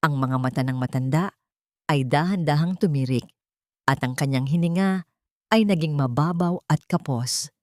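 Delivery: usual speaking voice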